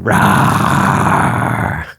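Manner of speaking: long, drawn-out raspy roar. Shown as a demonstration. Showing listeners the roaring from earlier, roar. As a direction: let out one sustained "rah" roar. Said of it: "like we're roaring".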